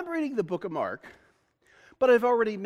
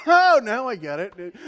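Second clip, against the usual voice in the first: loud, laughing "oh, now I get it"; laughing realization; 3 semitones higher, 9 dB louder, slower than usual. Retold or imitated. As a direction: burst out laughing as it finally clicks. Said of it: "I'm like".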